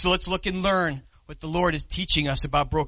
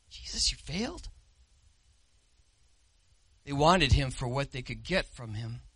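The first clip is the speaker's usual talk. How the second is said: ordinary delivery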